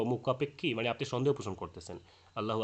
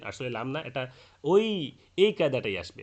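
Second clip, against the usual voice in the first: ordinary talk, nothing marked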